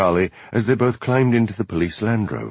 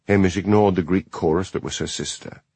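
ordinary delivery

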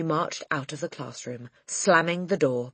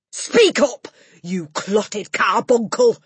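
much higher, loud, slow roar; roaring insult; 7 semitones higher, 11 dB louder, slower than usual. Acted. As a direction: spit the insult at the girl. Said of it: "roared the Trunchbull".

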